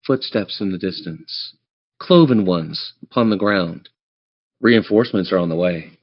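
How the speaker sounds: ordinary talk, nothing marked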